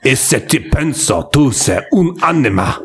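put-on Italian accent. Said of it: "This is an accent I didn't know I needed".

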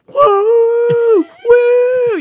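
high mock hoots, loud; imitating hooting women. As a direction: whoop like women cheering at a party. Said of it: "these women start hoot-, hooting and hollering".

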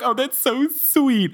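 high, drawn-out weepy gushing; mock tearful McGonagall. Teacher moved by the boys' lie, mock tearful. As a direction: imitate being moved to tears, slow and high. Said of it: "Then McGonagall starts crying".

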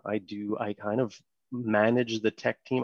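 usual speaking voice

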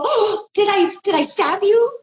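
high, teasing mock alarm; playful flirting.

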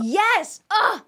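high excited outburst of agreement. Real, not acted. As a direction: burst out high with relief, yes that's it. Emphatic agreement with a friend.

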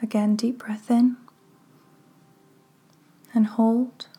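measured, hushed phrases that leave room to breathe; guided meditation. Real, not acted. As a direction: guide them gently into relaxation, unhurried.

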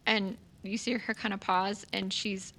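ordinary talk

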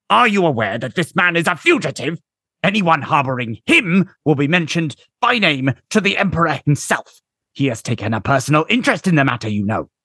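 high, pompous officer voice; indignant commander voice. A narrator's reading. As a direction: threaten like a haughty commander.

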